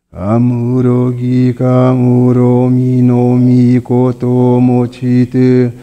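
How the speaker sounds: ordinary talk, nothing marked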